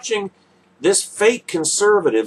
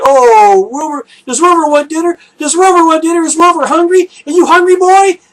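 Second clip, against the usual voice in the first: put-on high, loud, fast doggy baby talk; 12 semitones higher, 8 dB louder, faster than usual. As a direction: coo at the dog in a much higher, louder voice. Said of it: "What you say to your dog".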